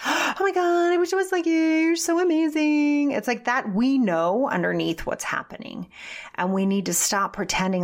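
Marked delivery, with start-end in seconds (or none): 0.0-3.1 high, loud, gushing mock praise. One woman fawning over another, high gushing mock praise. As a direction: coo it like an over-the-top fan.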